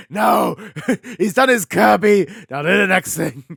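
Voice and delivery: loud gleeful yelling; excited shout. Acted. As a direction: shout it like a demanding fan. Mock demand at Sakurai.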